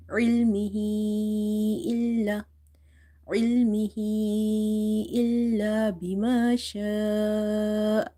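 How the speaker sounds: علمه with its last vowel held long before إلا; long madd. Shown as a demonstration. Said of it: "That much you have to stretch it".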